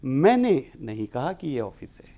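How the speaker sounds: मैंने hit firmly at the start, then a falling line; teacher stressing मैंने in the example. Shown as a demonstration. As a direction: push मैंने, not someone else. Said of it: "पहले शब्द पे।".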